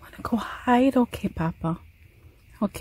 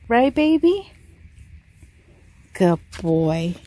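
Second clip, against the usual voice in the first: ordinary delivery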